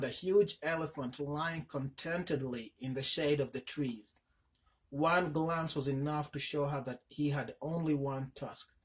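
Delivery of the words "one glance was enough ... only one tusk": plain reading voice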